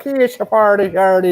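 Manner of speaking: put-on high, lilting botched Irish accent; put-on Irish accent slipping into Jamaican; Irish turning Jamaican. Friend shows his failing accent, Irish gone Jamaican. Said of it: "when I do the Irish accent".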